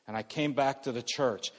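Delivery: ordinary talk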